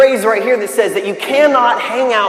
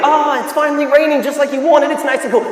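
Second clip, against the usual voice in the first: higher, upbeat delight; acted bright enthusiasm, pitched up; cheerful; 5 semitones higher than usual. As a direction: cheer up a grumbler. Cheerful voice against the rain complainer.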